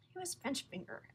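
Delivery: soft unvoiced whisper; whispered question. A narrator's reading. Young lion whispering to the circus man. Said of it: "asked the young lion".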